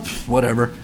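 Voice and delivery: low, quiet brush-off; dismissive. Retold or imitated. Twelve-year-old shrugging off bad language, dismissive. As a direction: shrug it off under the voice.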